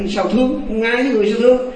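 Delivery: high, quick disbelief; retold protest thrown out fast and high; incredulous question. Husband asks how ghost can love human, incredulous.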